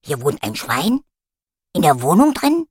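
puppy can't believe it; surprised dog voice. A narrator's reading.

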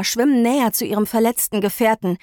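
ordinary delivery

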